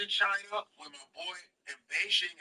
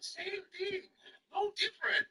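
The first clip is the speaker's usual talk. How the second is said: high-pitched mimicked street seller; insistent Chinese vendor; 14 semitones higher, 4 dB quieter than usual. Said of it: "He goes".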